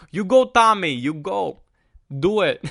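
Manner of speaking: cheering fan in a put-on Boston accent. Boston fans cheering on Tom Brady, in a mock Boston accent. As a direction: hype him up like a Boston local. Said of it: "that's my Boston accent".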